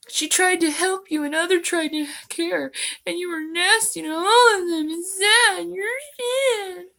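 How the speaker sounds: high, whiny cartoon kid voice, rising to a squeak at the end; Cartman impression. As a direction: put on a bratty cartoon boy. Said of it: "Cartman impression".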